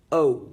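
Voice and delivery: "Oh" drawn out, starting wide and rounding; open-mouthed vowel. Shown as a demonstration. Teacher models the sound for a class, "Oh" rounded and exaggerated. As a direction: say "Oh" as one big, clear isolated vowel. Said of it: "you've got the accompanying hand signal".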